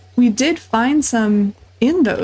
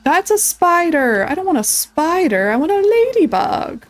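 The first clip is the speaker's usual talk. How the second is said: startled whine; 6 semitones higher than usual. Real, not acted. Gamer spotting a spider instead of a ladybug, high alarmed whine.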